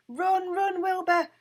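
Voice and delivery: high, loud panicked cry; shrieking. A narrator's reading. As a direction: shriek a warning. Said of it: "shrieked Winnie".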